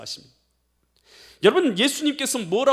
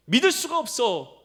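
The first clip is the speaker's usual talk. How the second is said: doubting Thomas; 5 semitones higher, 3 dB louder than usual. Acted. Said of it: "도마가 거기서 그렇게 말한".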